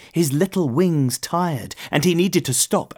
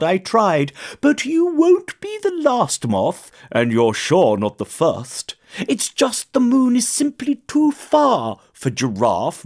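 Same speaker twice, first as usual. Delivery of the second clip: lightly voiced owl, rising in pitch; reassuring owl with animated swings in pitch; wise owl; 6 semitones higher than usual. A narrator's reading. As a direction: share hard-won wisdom with a youngster.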